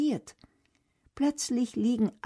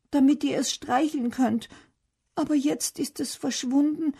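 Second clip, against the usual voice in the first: put-on higher-pitched worried child, lightly distressed; mildly tearful; 6 semitones higher than usual. A narrator's reading. Mira tells Bernd her guinea pig is lost, mildly tearful. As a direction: plead in a higher voice, close to tears. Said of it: "Sie muss laut schluchzen".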